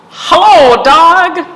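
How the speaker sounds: high, loud cheery duck hello; Duck's greeting. A narrator's reading. Storyteller voicing Duck greeting Cow, high and loud. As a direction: call out brightly as Duck.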